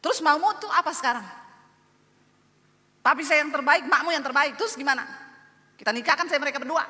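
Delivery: hammed-up fed-up wife; emotional. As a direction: argue back at the husband, heated and insistent. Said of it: "Saya tambah emosi nggak?".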